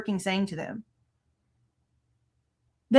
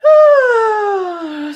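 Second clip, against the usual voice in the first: loud, high frustrated groan; loud exasperated groan; 10 semitones higher, 10 dB louder than usual. Real, not acted. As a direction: let out a big fed-up groan. Frustrated over muted footage.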